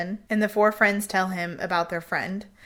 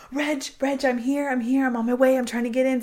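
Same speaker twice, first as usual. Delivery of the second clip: higher and louder, calling through a locked door; 5 semitones higher, 4 dB louder than usual. Retold or imitated. Neighbor calling through the locked door to the missing woman, urgent call. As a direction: shout to her that help is coming, voice up high.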